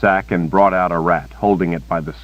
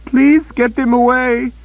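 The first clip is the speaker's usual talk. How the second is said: shrill high wail of terror; terrified high-pitched wail; 6 dB louder than usual. A narrator's reading. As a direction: wail the word in panic. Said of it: "Kara wailed".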